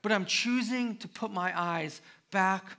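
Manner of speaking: loud, high, pleading confession of trust; impassioned testimony. Pretend. Believer sharing their story with someone, loud fervent testimony. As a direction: cry it out like your own story. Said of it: "To say".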